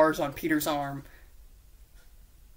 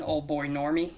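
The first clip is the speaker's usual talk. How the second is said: usual speaking voice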